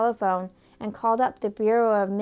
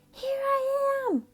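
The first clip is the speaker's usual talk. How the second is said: weak tiny voice; slower than usual. A narrator's reading. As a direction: call out from far away, tiny. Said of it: "he had such a weak voice".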